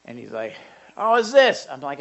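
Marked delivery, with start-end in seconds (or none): 1.0-1.7 put-on loud, high drunken slur; hammered guy. Drunk stranger asking about gospel tracts, loud and slurred. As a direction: play a rowdy drunk butting in. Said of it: "this guy just completely hammers".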